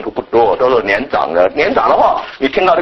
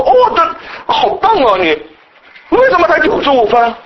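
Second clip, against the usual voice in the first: higher, slow mock praise turning to reproach; mock parent; 6 semitones higher, slower than usual. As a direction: fake praise that sours into blame. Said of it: "父母会表扬你说". (父母假装表扬孩子，随即转为责怪)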